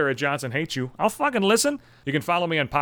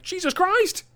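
high-pitched put-upon whine; a kid pleading it was only two wrong; exasperated kid; slower than usual. Retold or imitated.

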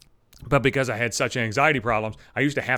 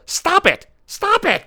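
screamed much higher and louder, losing it; furious shout; 5 dB louder, much slower than usual. Acted. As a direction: yell it out at the texters. Ranting at gym phone users.

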